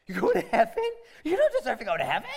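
high, loud, laughing outrage; fast incredulous scoff, half laughing; shocked disbelief. Acted. Preacher mocks a believer's smugness with loud incredulous laughter.

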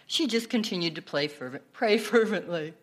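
usual speaking voice

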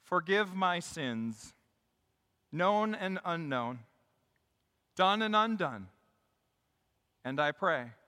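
slow, measured petition; leading prayer. Real, not acted. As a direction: pause between phrases as you lead prayer. Leads a prayer.

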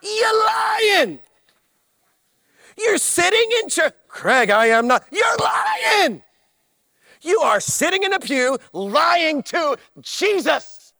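very high, loud, mock-accusing yell; accusing shout. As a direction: call them out across the pews. Preacher accuses congregation.

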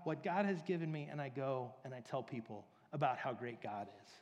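normal talking voice

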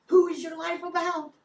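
loud, high, urgent repeat; booming preacher challenge; forceful preaching shout. Real, not acted. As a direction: hammer the question home at full volume. Same question repeated, forcefully.

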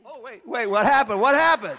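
loud, hammed-up shock; mock surprise. Pastor faking a miracle, loud mock surprise. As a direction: fake amazement, laughing.

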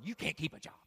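low taunting voice of failure. Pretend. Failure taunting the jobless listener, low quiet sneer. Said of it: "failure says this".

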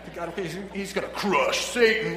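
a hint of booming announcer; lightly hyped monster truck promo. Shown as a demonstration. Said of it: "monster truck voice".

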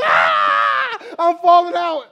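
put-on high, loud shout of a crying toddler; imitating a tantrum. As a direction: howl like a three-year-old falling out. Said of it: "He's just falling out crying".

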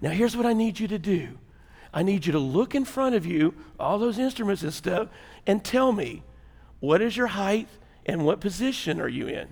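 very soft, patient instruction; calming voice. Acted. Air traffic controller guiding passenger through instruments, calming voice. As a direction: gently coax the listener, keeping it hushed. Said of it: "He's a calming voice".

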